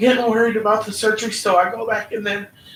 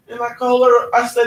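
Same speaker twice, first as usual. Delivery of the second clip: normal talking voice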